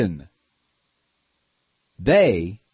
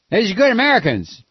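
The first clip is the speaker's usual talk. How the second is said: mock Bush; 7 semitones higher, 5 dB louder, faster than usual. Mocking Bush's generalities.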